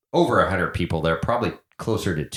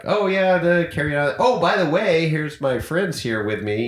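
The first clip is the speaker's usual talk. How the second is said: mock snooty celebrity; 9 semitones higher than usual. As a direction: toss off the friends like a pretentious star, mocking. Mocking self-absorbed celebrity types.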